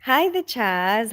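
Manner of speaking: normal talking voice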